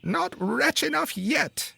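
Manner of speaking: very high, slow mocking bird voice; taunting sparrow. A narrator's reading. Sparrow mocking the unlucky carter, slow high taunt. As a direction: taunt him slowly from up high. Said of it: "said the sparrow".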